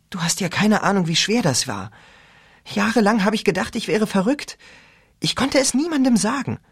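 lightly voiced teen girl; girl's voice. A narrator's reading. Rachel voiced.

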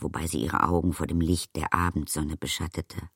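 ordinary delivery, nothing marked

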